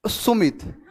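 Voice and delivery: very soft, meek mock reply; imitated failing voice. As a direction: squeeze the name out as if too nervous to speak. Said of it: "आवाज ही नहीं आ रही है". (घबराए आदमी की बहुत दबी आवाज़ की नकल)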